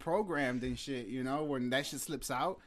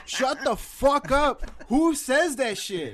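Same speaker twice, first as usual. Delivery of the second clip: loud, high, drawn-out mock outrage; high-pitched retold shout of indignation; outraged girlfriend; 11 semitones higher, 10 dB louder, much slower than usual. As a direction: imitate a girlfriend yelling in slow disbelief. Acting out girlfriend's outraged reaction.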